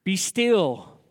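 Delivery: preacher voicing Christ calling out to the storm; called out command. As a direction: call out over the wind with authority. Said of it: "and called out".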